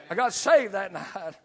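ordinary talk

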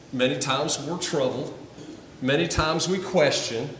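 ordinary delivery

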